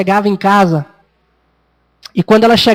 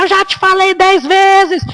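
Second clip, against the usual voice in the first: slow, much higher, mock-exasperated; exasperated parent; 9 semitones higher, slower than usual. Tired father scolds his son after work, slow and high-pitched. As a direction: drag it out like a fed-up dad. Parent finally snaps at child.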